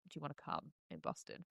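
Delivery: very soft, low, quick repeat; low, hushed, hurried re-reading of a text; quoted repeat. Retold or imitated. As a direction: say it again quickly under your breath. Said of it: "Reread it".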